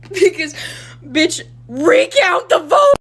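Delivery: high, loud, drawn-out yell; screamed demand. Acted. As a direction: explode out of reassurance into a scream. Calm reassurance breaks into demand.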